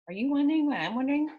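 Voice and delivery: usual speaking voice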